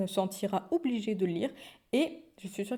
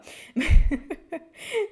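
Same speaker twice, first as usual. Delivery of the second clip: burst of laughter; 7 semitones higher, 9 dB louder than usual. Real, not acted. Laughing at her own vagueness.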